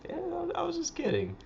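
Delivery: high breathy whisper. Retold or imitated. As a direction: whisper it sheepishly. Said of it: "you're like".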